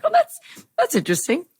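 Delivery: high, laughing polite surprise; awkward politeness. Retold or imitated. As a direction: fake interest in the fan's reply, with a laugh. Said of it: "And I was like".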